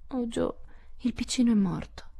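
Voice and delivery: high, sorrowful lament; grieving moan. A narrator's reading. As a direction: break the sad news in a high moan. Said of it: "gemette Beth".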